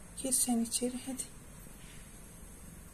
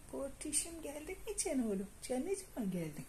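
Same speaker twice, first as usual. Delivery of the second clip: higher, soft pet talk; cooing to a pet; 5 semitones higher, 6 dB quieter than usual. Real, not acted. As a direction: coo at the cat. Kediye sevgiyle sesleniyor. (kediye şefkatle, ince sesle)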